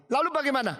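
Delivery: high, urgent question; anxious demand. Acted. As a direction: press for what happened next. Brother anxiously asks what happened.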